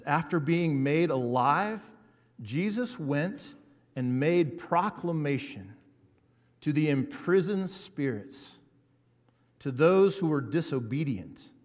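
slow, weighty scripture reading. Acted. Pastor reading First Peter to the congregation, slow and weighty. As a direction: let each phrase land slowly.

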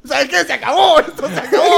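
loud, high, laughing dramatic dumping; mock outraged breakup. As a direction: storm out of the relationship. Acting out the breakup over a fart.

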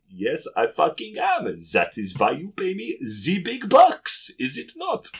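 put-on hammy German accent boasting; fake German accent. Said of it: "fake German accent".

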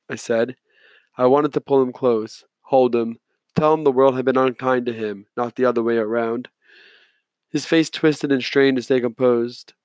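usual speaking voice

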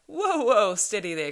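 big high "whoa" easing down; loud, high checking call; loud cry to halt. A narrator's reading. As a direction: call out loudly to halt runners, then settle. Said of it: "cried the old man".

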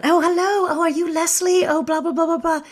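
very high, badly faked South African greeting; Candy. New cruise director meeting the company manager, high hammed-up accent. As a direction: chirp away in a poor South African impression. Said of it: "I can't do the impression".

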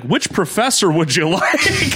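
laughing mock question. Mocking college staff.

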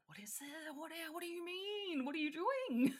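high, startled questions; alarmed confusion. Retold or imitated. A baffled viewer reacting to the horn, high and alarmed. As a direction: fret at what's happening, pitch up. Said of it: "And it's like".

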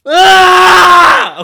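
loud high scream. Real, not acted. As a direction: let out a big scream. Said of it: "I know that I screamed".

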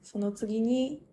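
ordinary delivery

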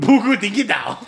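high, hammed-up fake French; over-the-top mock French; bad French accent. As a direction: mangle it like a cartoon Frenchman. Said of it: "trying to get my French on".

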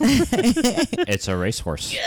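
slow, high teasing reveal; gleeful reveal. Pretend. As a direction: draw it out to rub it in.

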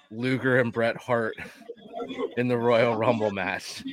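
usual speaking voice

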